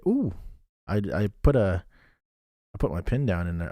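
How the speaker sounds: usual speaking voice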